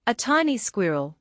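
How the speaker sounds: ordinary delivery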